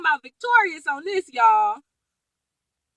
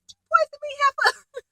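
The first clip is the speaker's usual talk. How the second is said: much higher, put-on accusing old-man voice; mock old husband; 12 semitones higher than usual. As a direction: play a cranky old husband teasing his wife.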